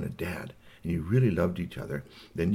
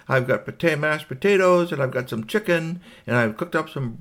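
higher, brisk wake-up call; wife waking husband; 6 semitones higher, 6 dB louder than usual. Acted. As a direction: rouse a sleeper with the dinner plans in a raised pitch. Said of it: "she woke up Clem Goodlight and said".

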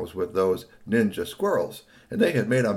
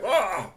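higher-pitched hurt cry; toy's yelp; 9 semitones higher than usual. A narrator's reading. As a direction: yelp as a stone hits you. Toy hit a second time.

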